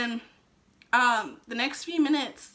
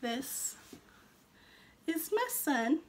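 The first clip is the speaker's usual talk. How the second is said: ordinary talk, nothing marked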